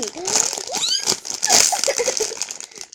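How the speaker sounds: high delighted laugh; happy giggle. Real, not acted. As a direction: giggle with pure joy. Happy about the squishy.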